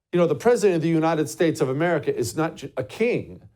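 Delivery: ordinary talk, nothing marked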